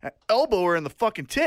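ordinary delivery

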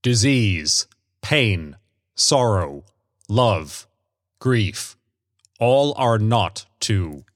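hint of ominous golem, word by word; harsh, monotonous stranger. A narrator's reading. Stranger lists human ills, mildly harsh monotone. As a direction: list in a lightly voiced monotone. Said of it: "His voice was harsh and monotonous".

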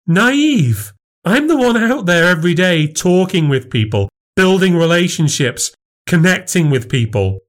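very high, stung retort; indignant. Acted. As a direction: throw the insult back at him. Wife outraged at the insult.

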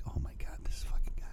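imitating mortified companions under their breath; whispered aside, voiceless and very soft; embarrassed whisper. Embarrassed travel companions about their friend going off, mock whisper. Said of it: "He gets so embarrassed".

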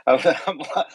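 breaking into laughter mid-word; laughing. Real, not acted. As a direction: laugh through the words. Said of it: "I'm laughing here".

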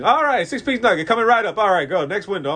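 loud, high auction-caller patter; auctioneer. Retold or imitated. McDonald's worker calls a nugget order like an auctioneer. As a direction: call the order like you're selling lots. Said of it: "fucking auctioning nuggets".

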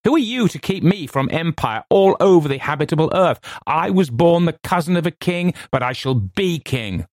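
high, grandiose proclamation; fevered, high-pitched declaiming; impassioned cry. A narrator's reading. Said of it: "I cried".

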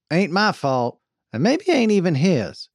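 folksy indignant protest; defensive wagon boss. A narrator's reading. Voices Jane arguing back.

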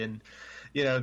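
ordinary talk

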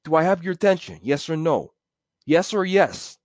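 high, pushy grab for attention; cocky, insistent questioning; attention grabber. Shown as a demonstration. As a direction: demand they listen to you. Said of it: "That's akin to".

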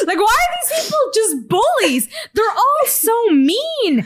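high-pitched laughing protest; laughing disbelief. Real, not acted.

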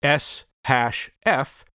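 slow, spelled-out code; dictating. Shown as a demonstration. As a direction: say each symbol slowly. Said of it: "For example".

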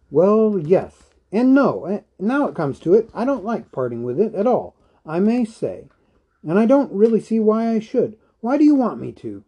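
agitated, high-pitched and hurried; defensive. A narrator's reading. Bilbo turns defensive and agitated.

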